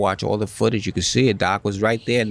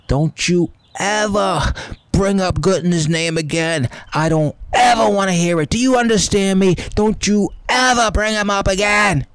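furious, high-pitched dressing-down; imitated angry tirade; screaming boss; 10 semitones higher, 3 dB louder than usual. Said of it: "Steinbrenner screamed at me".

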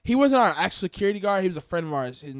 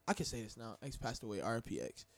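much softer and lower, respectful; hushed and solemn; 7 semitones lower, 16 dB quieter than usual. Real, not acted. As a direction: drop your voice to honor him. Mentions friend's death.

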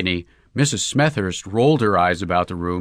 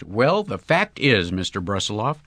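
plain reading voice

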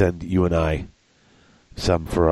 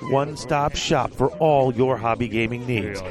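quiet, higher ad read voice; 8 semitones higher, 4 dB quieter than usual. Acted. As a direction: read it like a hobby store commercial. Reads sponsor ad.

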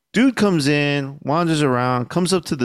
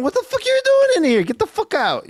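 high, fast outraged yell; mock angry yell; faster than usual. Shopkeeper throwing out a drunk thief, mock angry yell. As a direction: throw him out, much higher and rushed.

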